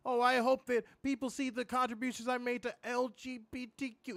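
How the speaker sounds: high, sing-along earnest mock pandering; virtue-signaling pandering. Podcaster mocking a wrestler's pandering promo, mock-earnest. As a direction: mimic someone fishing for approval. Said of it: "did the virtue signaling, pandering thing".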